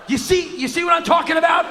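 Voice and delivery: high, loud comic shout; exasperated outburst. Acted. Comic appeals to audience over stupid question, exasperated shout. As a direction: shout your vindication to the crowd.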